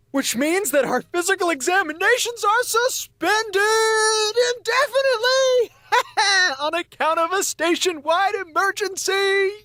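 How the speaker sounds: very high, giddy cheering with laughter; crowing with delight; gleeful triumph. Acted. Eiffel tells listeners the physicals are cancelled, gleeful triumph.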